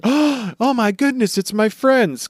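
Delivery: high, drawn-out delighted squeal; Chopper. Acted. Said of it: "Chopper immediately is like".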